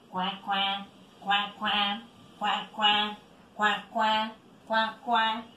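low duck quacks in steady pairs; imitación de pato. Shown as a demonstration. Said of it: "cuando imitamos su sonido". (graznido grave de pato)

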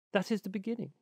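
high little bird voice; high-pitched storybook linnet; voiced Linnet. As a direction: answer firmly in a high songbird voice. Linnet answers the Water Rat.

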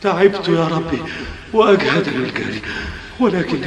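normal talking voice

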